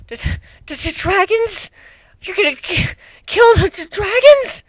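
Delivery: very high, shaky stuttering griffin; terrified stammer. Acted. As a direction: stammer in fear. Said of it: "looked like she was about to cry".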